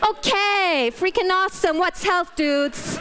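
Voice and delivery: a hammed-up American accent mocking the talk. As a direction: gush it like an excited American surfer. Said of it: "I have to do it in American".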